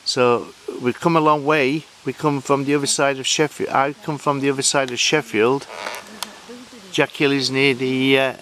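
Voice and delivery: usual speaking voice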